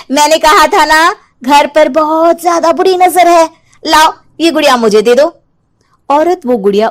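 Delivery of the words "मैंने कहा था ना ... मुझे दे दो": higher, knowing and insistent; coaxing the doll back; sly witch. Acted. (ऊँची, चालाक आवाज़ में चुड़ैल)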